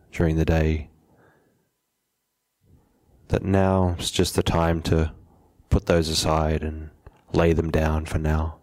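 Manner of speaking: unhurried meditation guide, long pauses between phrases; measured, settled meditation instruction; guided meditation. Acted. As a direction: calmly guide them to settle, leaving space after each phrase.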